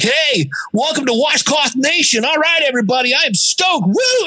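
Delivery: high, whooping show-opener parody; mock a host bursting with hype; over-hyped podcaster. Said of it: "hear the person that's like".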